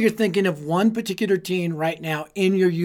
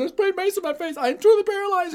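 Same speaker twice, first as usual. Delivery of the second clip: put-on panicked kid; 12 semitones higher than usual. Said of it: "I said".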